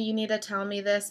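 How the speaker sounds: usual speaking voice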